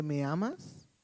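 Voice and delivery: lightly voiced wife, softly asking; wife's question. Wife turning suddenly to her husband, asking uneasily. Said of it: "la esposa voltea y te dice".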